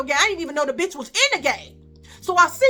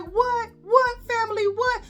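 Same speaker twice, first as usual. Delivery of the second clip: high, repeated baffled questions; acted-out astonished questioning; incredulous; 9 semitones higher than usual. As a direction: keep the pitch high, in disbelief. Retold incredulous reply about family.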